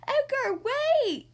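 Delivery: high, loud urgent shout. A narrator's reading. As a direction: call out to stop him. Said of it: "shouts Vee".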